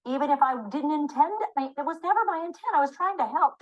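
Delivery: acted self-justifying objection; mild protest. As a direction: plead good intentions, pitch lifted. Voices the carer's own protest.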